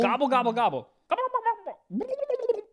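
high gobbling turkey call; turkey impression. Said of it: "that's a good turkey impression".

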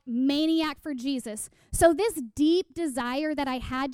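ordinary delivery

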